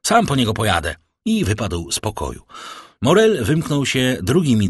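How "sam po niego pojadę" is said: high, loud, frantic order; acted panicky shout, easing off at the end; urgent cry. A narrator's reading. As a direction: call out high, then rush the rest. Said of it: "zawołał".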